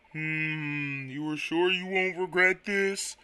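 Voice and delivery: slow, soft, sleepy drawl; the slow Quagsire voice kept up. Acted. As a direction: let the words crawl out with a hum. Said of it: "my Quagsire voice".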